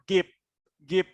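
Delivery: "give" cut short, final v sound missing; wrong GIVE. Shown as a demonstration. Teacher showing students what to avoid, "give" missing its ending. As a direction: say "give" without its last consonant. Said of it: "Don't say the word".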